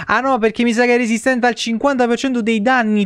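ordinary talk

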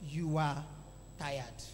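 very low, drawn-in lulling voice; tempting inner voice. Retold or imitated. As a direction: drop right down to coax them to sleep. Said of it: "Another voice said".

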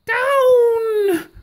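high, ringing command down to the ground; witch's cry. A narrator's reading. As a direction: call the broom down like a witch. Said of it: "cried the witch".

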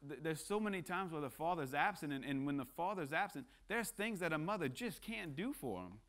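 normal talking voice

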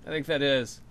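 ordinary talk, nothing marked

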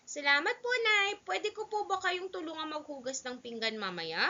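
put-on high-pitched polite child; young boy. Son offering to help mother with dishes, childlike. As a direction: pipe up as a helpful little boy.

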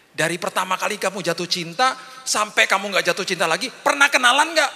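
eager question. Retold or imitated. Father asking daughter eagerly.

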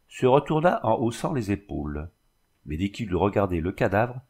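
ordinary delivery, nothing marked

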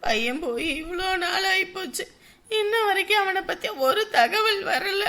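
high, tearful lament; weeping mother's complaint; crying. Acted. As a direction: plead through sobs, voice pitched high. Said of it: "அழுதுகிட்டு இருக்க".

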